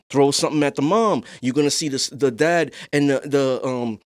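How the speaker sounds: ordinary delivery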